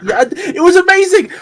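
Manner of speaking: high, loud burst of delight; excited. Real, not acted. Recalling showing a friend the video, excited. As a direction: blurt it out, thrilled.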